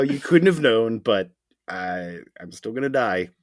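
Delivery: loud, high passive aggressive; guilt-trip them loudly. Retold or imitated. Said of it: "passive aggressive".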